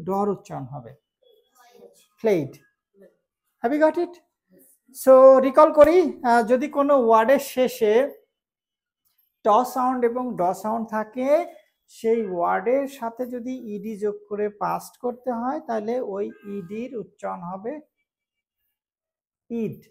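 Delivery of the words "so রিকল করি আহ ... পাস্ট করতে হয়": ordinary delivery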